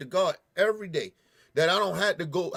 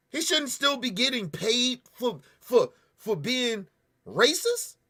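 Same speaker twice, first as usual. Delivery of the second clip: higher, slow and emphatic; slow angry insistence; 5 semitones higher, 4 dB louder than usual. Real, not acted. Telling the department what the officer deserves, slow and furious. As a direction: lay down each word in anger.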